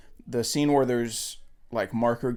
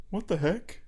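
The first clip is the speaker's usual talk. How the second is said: put-on weird voice, pitched up; weird character voice; 7 semitones higher, 4 dB quieter than usual. Quoting a movie character stopped on the road, in an imitated weird voice. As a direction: imitate an actor's odd, higher-pitched voice. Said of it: "he's also doing kind of a weird voice".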